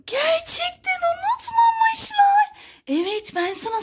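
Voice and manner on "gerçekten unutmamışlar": gasp, then high, slow doll voice; much higher, drawn out in wonder; amazed doll. Pretend. Doll finding she was not forgotten, high amazed voice. Cry Baby discovers the surprise party.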